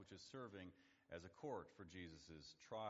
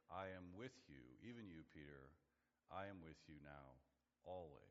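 tender reassurance; 5 semitones lower, 5 dB quieter than usual. Acted. Jesus reassuring the fleeing disciples.

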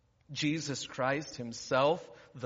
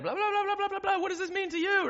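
high-pitched excited gabble; mile-a-minute excited interviewer; 5 dB louder, faster than usual. Retold or imitated. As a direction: rattle it off like a giddy post-game reporter. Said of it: "talking like a mile a minute. They're so happy and excited".

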